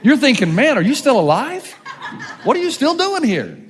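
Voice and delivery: normal talking voice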